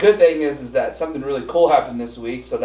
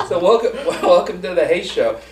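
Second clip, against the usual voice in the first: laughing, louder show welcome; hearty greeting; 7 semitones higher, 7 dB louder than usual. Acted. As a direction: welcome them through a laugh. Restarting the show intro laughing.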